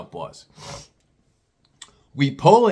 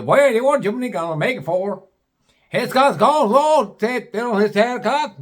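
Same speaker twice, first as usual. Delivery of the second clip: much higher, slurred backwoods mumble; garbled hillbilly talk; 9 semitones higher, 4 dB louder than usual. Retold or imitated. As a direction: make it barely understandable. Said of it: "this is how they are talking".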